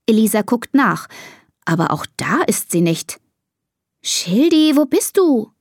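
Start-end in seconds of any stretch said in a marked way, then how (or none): 4.0-5.5 high-pitched call for a lost pet; searching call. A narrator's reading. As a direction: call out, wondering where it is. Child searches, calls missing pet.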